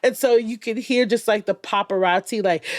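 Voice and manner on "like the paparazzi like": usual speaking voice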